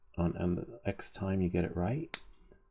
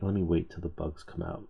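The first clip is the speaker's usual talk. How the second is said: usual speaking voice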